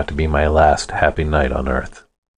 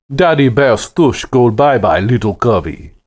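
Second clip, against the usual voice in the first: loud, higher Russian taunt; booming Russian accent; 5 semitones higher, 3 dB louder than usual. Acted. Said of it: "his voice boomed like a backfiring car with a Russian accent".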